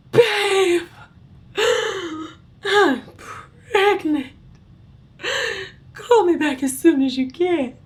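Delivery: hammed-up high, loud, gasping wail; mock-distraught, breathless, high-pitched plea; dramatic voicemail. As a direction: gasp between words like a melodramatic sobbing message. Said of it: "like, dramatic voicemail".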